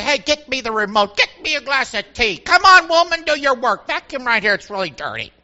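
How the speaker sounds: mock husband barking orders, building to a loud peak; imitating a domineering husband; bossy husband. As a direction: order the wife about, hammed-up. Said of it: "boss our wives around".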